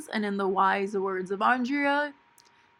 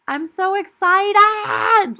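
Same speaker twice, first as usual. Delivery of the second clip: put-on high, loud, drawn-out valley girl squeal; 12 semitones higher, 10 dB louder, much slower than usual. Shown as a demonstration. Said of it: "that's my Valley Girl voice".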